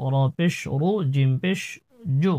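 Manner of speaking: usual speaking voice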